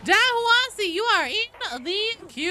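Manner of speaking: high loud slow call; sing-song call to the queue. Real, not acted. Calling out a name loudly.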